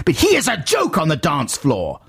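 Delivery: high-pitched mock outburst; indignant letter-writer shouting the punchline. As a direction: raise the pitch in mock outrage. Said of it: "Brackets, shouting at the end".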